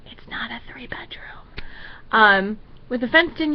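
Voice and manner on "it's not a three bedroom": whispered aside to the camera. Real, not acted. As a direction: drop to a whisper, a sly aside. Aside after quoting prayer.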